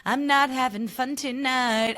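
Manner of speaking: high, slow whiny mock; mocking Amanda's voice. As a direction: whine it out nasally-shrill in mockery. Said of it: "can't handle it with her voice".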